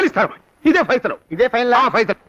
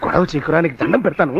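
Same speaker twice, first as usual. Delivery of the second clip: much lower, begging hush; lowered pleading; 10 semitones lower than usual. Acted. Cornered debtor begging the blackmailer to stop shouting, low.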